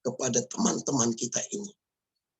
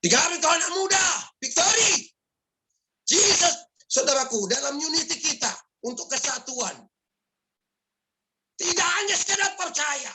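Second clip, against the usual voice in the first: fiery preaching. Acted. Preaching climax.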